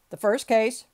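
loud courtroom call. A narrator's reading. Judge calling the first case, loudly. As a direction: project it firmly to the whole room.

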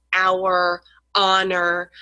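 "hour" and "honor" begun on the vowel, H silent; soft H. Shown as a demonstration. Teacher listing soft H examples, H left silent. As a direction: drop the H in "hour" and "honor", each word set apart. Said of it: "that nice soft H sound".

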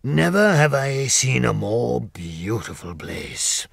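slow, different-toned lion in delight; admiring the forest; Lion savoring the view slowly; joyful Lion. Acted.

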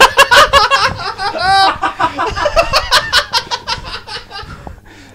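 loud high laugh. Real, not acted. Big laugh at a joke.

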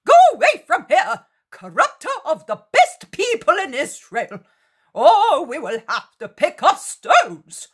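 high, wild heckling with swooping pitch; frenzied hecklers. A narrator's reading. Hostile crowd threatens Jesus with stones, frenzied and high. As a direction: rail at him like a frenzied mob.

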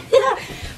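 a bright high-pitched laugh burst; laughing at herself posing for the thumbnail, high laugh. Real, not acted.

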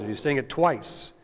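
normal talking voice

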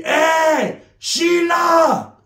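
high, loud call to his woman; shouting for Sheila across the house. Retold or imitated. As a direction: call her from the next room. Calling wife across house.

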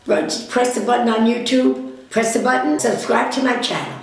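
loud, calling across the room. Real, not acted. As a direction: raise your voice to reach the next room. Calling out to someone off-mic.

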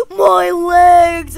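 loud, high drawn-out cry; hammed-up injured wail; mock agony. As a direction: scream in play pain. Figure hit in the legs.

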